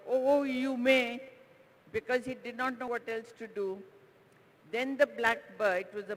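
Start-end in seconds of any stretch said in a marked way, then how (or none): 0.1-1.2 loud, slow gruff shout; a big gruff mountain booming out his permission; slow loud gruff shout, a little higher. Acted. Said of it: "he shouted in his gruff voice".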